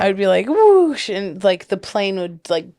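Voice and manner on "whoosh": high loud whoosh; whoosh sound. Retold or imitated. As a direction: mimic a swooping dive. The plane diving.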